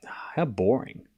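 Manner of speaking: breathy whisper, almost to himself; whispered aside. Real, not acted. Streamer mocking chat's dull view, in a whisper. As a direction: let it out under your breath, bored.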